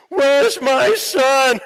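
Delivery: loud, very slow, high parent lament; mock parent wail. Parents shocked by son's stage video, slow drawn-out wail. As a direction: drag it out like a dismayed parent.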